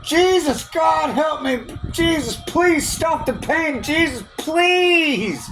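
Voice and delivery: high, slow, drawn-out pleading to Jesus; retold pleading cry. Man in pain at night crying out to Jesus, pleading. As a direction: beg for the pain to stop, half-crying. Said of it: "I started screaming out".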